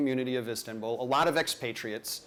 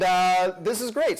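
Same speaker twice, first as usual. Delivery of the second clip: ordinary delivery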